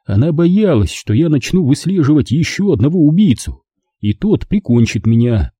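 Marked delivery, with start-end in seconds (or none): none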